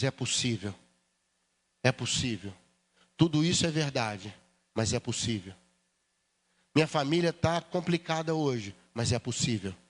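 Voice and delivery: low, soft repeated "é possível"; whisper-close declaration of hope; quiet faith. Acted. The believing church quietly declares hope, tender conviction.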